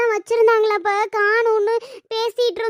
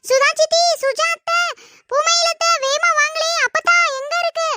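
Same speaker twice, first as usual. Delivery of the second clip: calling for help; 7 semitones higher than usual. Acted. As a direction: yell for the aunts to come quickly. Daughter calls relatives for help. (உதவிக்காக உரத்த அழைப்பு)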